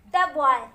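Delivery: द बॉय with a short "duh"; the boy demo. Shown as a demonstration. Teacher modelling द बॉय with a short "duh". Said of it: "देखिए कैसे".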